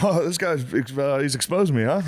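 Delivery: imitating the guy. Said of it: "And he was like".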